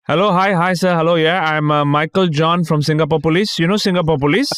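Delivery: put-on high-pitched Indian-accented phone scammer. Scam caller introduces himself as Singapore police, imitated Indian accent. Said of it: "I gotta try to do the accent, okay?".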